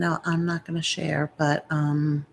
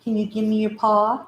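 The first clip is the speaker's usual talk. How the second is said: usual speaking voice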